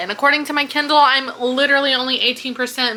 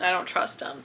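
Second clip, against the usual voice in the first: whispered aside; 5 semitones lower, 9 dB quieter than usual. Real, not acted. Aside after doubting Micah.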